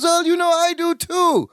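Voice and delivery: high, slowed, sing-song mock Balki; Balki impression. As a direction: float it up high like a cheerful cousin. Balki agreeing, retold.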